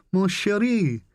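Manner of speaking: put-on a breathy Russian-accented coo, pitched high. A narrator's reading. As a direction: purr it like a Russian girl greeting her lover. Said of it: "in a Russian accent".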